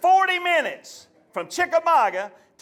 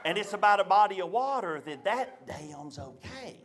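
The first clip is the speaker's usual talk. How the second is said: sweet mama voice; 3 semitones lower, 5 dB quieter than usual. Retold or imitated. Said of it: "She says".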